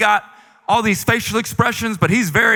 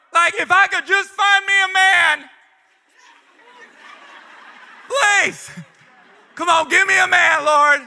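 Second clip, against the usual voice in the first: begging prayer; 11 semitones higher than usual. Retold or imitated. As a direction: beg the Lord shamelessly. Begging God for a man.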